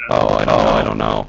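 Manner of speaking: distorted robotic mic voice; words come through like a robot. Real, not acted. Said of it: "Robot voice".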